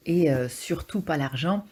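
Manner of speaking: ordinary delivery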